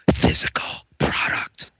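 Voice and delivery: hushed secret whisper. Shown as a demonstration. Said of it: "I'm gonna whisper into my microphone here".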